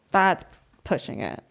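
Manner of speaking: ordinary talk, nothing marked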